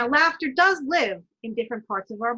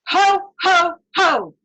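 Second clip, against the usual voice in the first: loud, higher Ho, ho from the diaphragm; belly laugh ho ho ho; 6 semitones higher, 9 dB louder, much slower than usual. Shown as a demonstration. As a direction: boom it out from the belly, like Santa. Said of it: "so breathe in".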